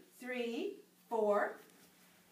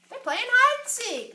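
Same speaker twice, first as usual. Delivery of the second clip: excited; 9 semitones higher, 7 dB louder than usual. Acted. Dinosaur excited about game.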